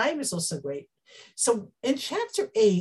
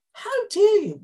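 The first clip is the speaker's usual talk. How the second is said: higher, louder how dare you; indignant; 11 semitones higher, 6 dB louder than usual. Retold or imitated. Ephraim confronting Yiftach, loud and indignant. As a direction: fling out the offended challenge. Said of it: "their language is much stronger".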